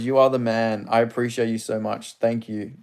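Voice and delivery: normal talking voice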